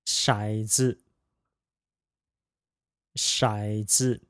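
骰子 said shǎi zi, low then light neutral; 骰子 with a third tone and an unstressed zi; practicing tones. Shown as a demonstration. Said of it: "neutral tone pair".

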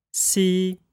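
See held high and level, Mandarin first tone. Shown as a demonstration. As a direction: keep See high and flat. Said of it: "Let's practice that".

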